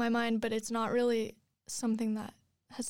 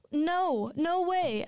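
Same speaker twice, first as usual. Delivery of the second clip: mildly raised, emphatic no; imagined firm refusal; 5 semitones higher, 3 dB louder, much slower than usual. Retold or imitated. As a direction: act out standing up for yourself. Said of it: "defend myself completely in front of him".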